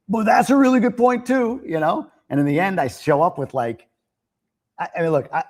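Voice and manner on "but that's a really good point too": much higher, brighter second "really good point too"; agreeing with both sides. Retold or imitated. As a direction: swing up high on "really" to agree with everyone. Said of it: "I'm that guy, like".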